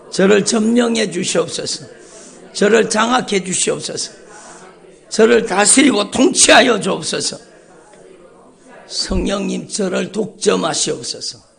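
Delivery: ordinary delivery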